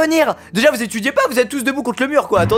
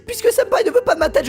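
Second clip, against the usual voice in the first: put-on lightly raised lovesick girl; higher, playing the obsessed girl; girly voice; 10 semitones higher than usual. Voicing the yandere girl. (voix de fille un peu aiguë)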